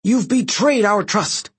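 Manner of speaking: high, slow, measured fury; seething accusation. A narrator's reading. Praetor accusing her guest of betrayal, seething and high. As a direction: draw out each word in outrage. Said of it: "Reyna's eyes seethed with rage".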